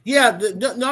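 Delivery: ordinary delivery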